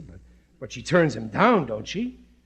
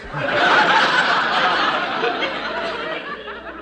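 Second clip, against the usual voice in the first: embarrassed laugh. Acted. Embarrassed, asks for glasses.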